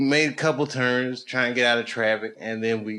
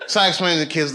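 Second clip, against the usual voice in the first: normal talking voice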